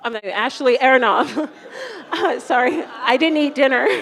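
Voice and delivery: loud, giggly words; laughing. Real, not acted. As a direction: laugh at your own slip. Teacher laughing at own mix-up.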